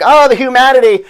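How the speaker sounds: high, loud wailing cry; screaming. Retold or imitated. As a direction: wail it like a horrified announcer. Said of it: "he's screaming".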